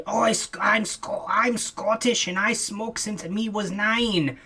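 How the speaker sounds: put-on lightly done Scottish accent, a bit slow. Imitating a Scottish smoker online, mild Scottish accent. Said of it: "all these, like, Scot".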